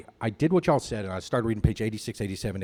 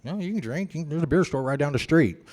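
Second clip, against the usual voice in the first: higher, louder sarcastic mock of the group; mocking sarcasm; 8 semitones higher, 6 dB louder than usual. Old-timers sarcastically dare the newcomer, mocking higher voice. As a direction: toss it off like a snide dare. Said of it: "they're like".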